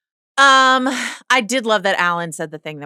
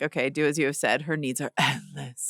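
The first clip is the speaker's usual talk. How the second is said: Corliss's weary resentment; 5 semitones lower, 9 dB quieter than usual. Retold or imitated. Quoting Corliss's complaint to co-hosts, low resentful delivery. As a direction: say it softly, heavy with frustration. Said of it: "the emphasis on endless".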